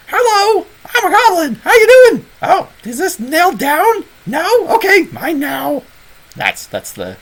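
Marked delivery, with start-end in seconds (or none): 0.1-5.8 shrill-high, loud goblin voice; high-pitched goblin. Shown as a demonstration. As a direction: squeal it out as a cheeky, grabby goblin. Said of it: "it's always that high pitched".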